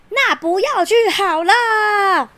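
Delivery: high, slow angry yell; angry shout. Acted. Fed-up king yelling at his son, slow high angry shout. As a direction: snap it at the stubborn son. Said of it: "他就大吼说".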